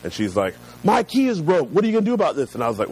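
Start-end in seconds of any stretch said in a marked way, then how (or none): 0.8-2.5 high-pitched mock tirade; retold angry outburst. Customer lighting off over a broken key, mock angry. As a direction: imitate an upset customer lighting into someone. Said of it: "lit off on me".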